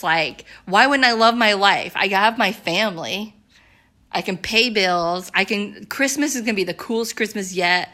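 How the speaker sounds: ordinary talk, nothing marked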